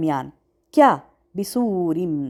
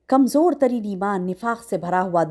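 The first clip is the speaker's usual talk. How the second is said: ordinary talk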